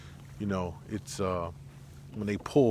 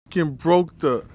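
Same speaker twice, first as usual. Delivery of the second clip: angry; 7 semitones higher, 10 dB louder, slower than usual. Real, not acted. Line broke.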